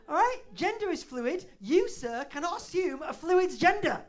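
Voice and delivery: high, loud mock outrage; mock indignant rant. Ranting at the waiter with mock outrage. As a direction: lecture him with fake fury.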